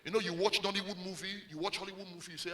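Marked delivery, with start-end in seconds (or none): none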